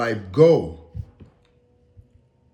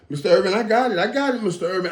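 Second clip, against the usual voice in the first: lightly voiced cocky young driver, higher; confident young driver; 6 semitones higher, much faster than usual. A young driver reassuring his passenger, lightly cocky. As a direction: brush him off with easy confidence. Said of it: "everybody always tells me".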